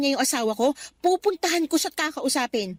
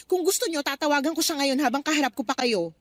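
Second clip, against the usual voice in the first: ordinary talk, nothing marked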